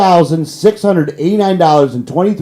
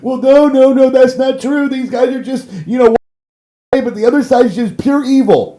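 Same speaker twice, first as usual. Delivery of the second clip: higher whiny mock protest; mocking naive voter; 8 semitones higher than usual. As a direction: imitate a gullible partisan whining. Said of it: "And I know people are like".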